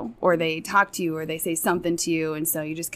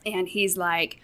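usual speaking voice

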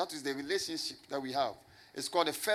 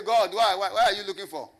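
much louder, higher "God, why, why"; frantic loud cry; 4 semitones higher, 9 dB louder than usual. Shown as a demonstration. Pastor mocking the wrong, searching prayer, loud and frantic. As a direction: yell "God, why, why" out into the void, desperate. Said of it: "Don't say".

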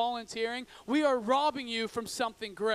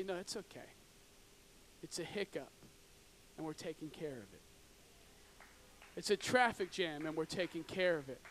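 much softer and lower, gently settling; calm reassurance; 6 semitones lower, 9 dB quieter than usual. Retold or imitated. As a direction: soothe yourself while stuck in traffic. Calm driver trusting the team.

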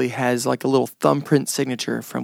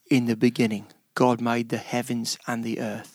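lower, softer poetic reading; 4 semitones lower, 5 dB quieter than usual. Shown as a demonstration. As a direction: say it low and grand, like scripture. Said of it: "that's not as cool as".

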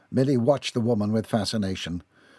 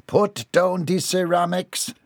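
slow, higher-pitched command; ordering; 7 semitones higher, slower than usual. A narrator's reading. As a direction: order it firmly, word by word. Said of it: "Missus Dixon ordered".

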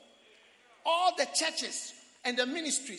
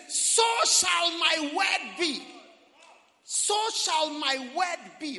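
loud, higher and very slow; booming scripture; 6 semitones higher, 11 dB louder, much slower than usual. Acted. Preacher booming God's word to the congregation, very slowly. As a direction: declare it with full force.

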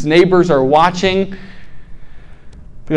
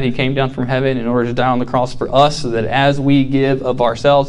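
earnest sermon; 6 semitones lower, 6 dB quieter than usual. Acted. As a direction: let the point land softly. Turns to sermon message.

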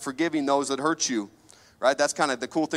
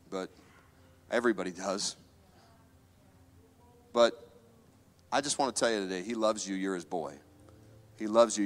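tender testimony; 2 semitones lower, 3 dB quieter than usual. Acted. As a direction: speak softly, as if confiding to one person.

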